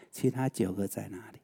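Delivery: hushed, sorrowful whisper. Retold or imitated. 讲道人轻声转述耶稣的叹息，低声耳语. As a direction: breathe it out as a lament. Jesus laments the nine lepers.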